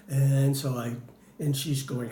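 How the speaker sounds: normal talking voice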